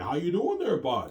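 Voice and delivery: put-on western Canadian accent. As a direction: mimic an out-west Canadian greeting. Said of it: "you go out west in Canada".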